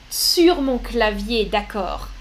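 ordinary talk, nothing marked

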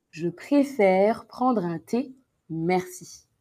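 slow, carefully spaced words; slow dictation. Shown as a demonstration. Teacher dictating the sentence to students, slowly. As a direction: dictate it word by word for the class.

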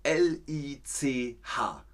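L I C H slowly, each letter separate; spelling out. Shown as a demonstration. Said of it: "So, jetzt ist es aber wirklich". (L I C H langsam buchstabiert)

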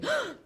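sharp, high intake of breath; startled gasp. Acted. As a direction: gasp as if caught out. Boss walks in during acted story.

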